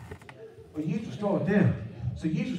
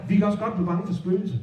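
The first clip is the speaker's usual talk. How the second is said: plain reading voice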